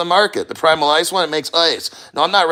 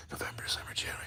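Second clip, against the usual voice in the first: breathy whisper, teeth-chattering cold; 21 dB quieter, much faster than usual. Real, not acted. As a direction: whisper the months under your breath. Listing months while in plunge.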